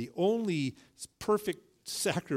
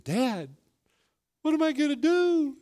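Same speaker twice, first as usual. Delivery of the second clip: son's worried plea; 12 semitones higher, 6 dB louder than usual. Retold or imitated.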